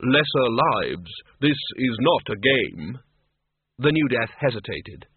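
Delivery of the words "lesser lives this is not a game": slow, grave and hollow; measured, heavy disapproval. Acted.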